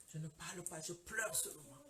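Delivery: low, soft, subdued; mock-tearful hush. Mother recalls only crying before the doctor, low and quiet. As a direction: drop down as if too tearful to talk. Re-enacts speechless tearful state.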